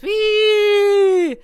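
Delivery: high, loud imitated screech; car screech. Imitating the car braking, a high mock screech. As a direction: mimic the brakes squealing. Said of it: "the car goes".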